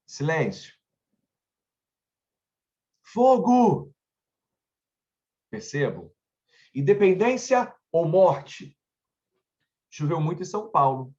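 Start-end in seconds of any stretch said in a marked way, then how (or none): 3.0-3.9 loud, high alarmed cry of fire; shouted fire. Shown as a demonstration. Teacher shouting an example word, loud alarmed cry. 6.7-8.8 proclaiming. Shown as a demonstration. Teacher acts famous proclamation.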